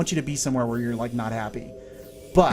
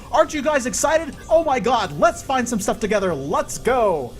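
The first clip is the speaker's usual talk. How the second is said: much higher and louder, hammed-up gushing excitement; mock overexcited streamer; 9 semitones higher, 4 dB louder than usual. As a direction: parody a hyper streamer, all fake cheer. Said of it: "I could be like this".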